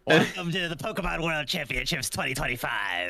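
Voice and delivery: put-on showman character voice; hammed-up mascot welcoming the crowd; Quilladin. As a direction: announce it as a cartoon host. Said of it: "we got a commenter who wanted to say something".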